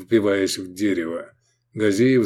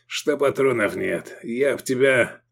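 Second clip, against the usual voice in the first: mild wheezing threat; slightly strained hoarse voice; hoarse croak; 2 semitones higher than usual. A narrator's reading. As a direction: rasp it with spent anger. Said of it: "прохрипел Мирарзал".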